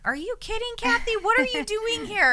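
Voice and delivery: can't believe she came, cry it out; stunned disbelief. Retold or imitated. Greeting Kathy at the door, high and stunned. Friend brings meal after loss.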